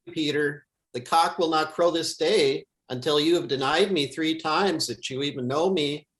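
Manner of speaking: ordinary talk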